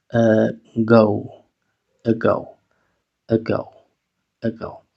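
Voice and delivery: "ago" with a weak schwa start, uh-GO; initial schwa. Shown as a demonstration. Teacher drilling the word, weak initial schwa. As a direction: begin "ago" with a neutral uh. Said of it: "La A va a sonar schwa".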